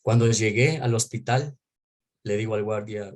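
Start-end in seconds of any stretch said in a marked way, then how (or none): none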